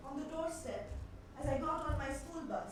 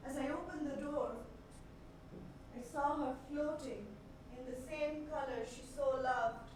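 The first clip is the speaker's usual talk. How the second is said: subdued horror; 2 semitones lower, 6 dB quieter than usual. Acted. Recalling finding the dead woman.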